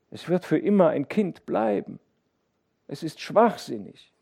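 hohe, klagende Mutterstimme; gramvoll. A narrator's reading. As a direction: dem Mann das Leid verzweifelt klagen. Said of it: "mit einem Ausdruck des tiefsten Grams". (klagende Mutter)

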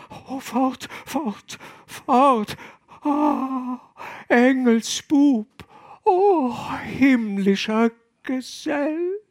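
drawn-out high moans of rapture; ecstatic cries. A narrator's reading. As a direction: cry out in mounting ecstasy. Woman at height of passion.

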